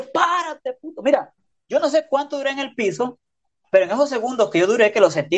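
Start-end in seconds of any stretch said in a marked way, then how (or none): 0.0-1.0 high, drawn-out retold call; held-back shout, pitched way up; mock yell. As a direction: imitate a friend calling to a downed fighter. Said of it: "un raza me grita afuera".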